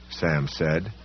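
ordinary delivery, nothing marked

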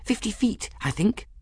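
very high, small voice; Folly's character voice. A narrator's reading. Folly estimates the distance for Bridget, in a high small voice. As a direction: answer in a tiny high-pitched voice.

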